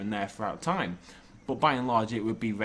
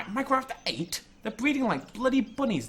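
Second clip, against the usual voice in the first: exasperated; 11 semitones higher than usual. Real, not acted. Too many rabbits breeding.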